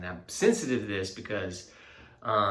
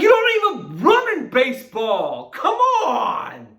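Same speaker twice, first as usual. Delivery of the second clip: high, loud, cracking outburst at his son; exasperated yell; 13 dB louder, slower than usual. Retold or imitated. Dad to his six-year-old who loved resting, high loud yell. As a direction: blast it out in disbelief, almost crying. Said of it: "I didn't say it like that".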